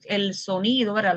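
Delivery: normal talking voice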